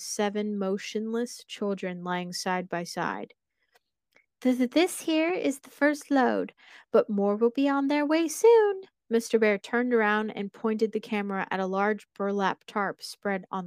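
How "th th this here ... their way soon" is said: high, muffled creepy mascot voice. Acted. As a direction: speak high through a bear mask. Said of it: "The unmistakable muffled voice spoke up".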